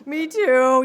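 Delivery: loud, overflowing "oh, me too"; a sudden gush of loud affection; heartfelt exclaim. Retold or imitated. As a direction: burst out with feeling. Touched reply to the old man.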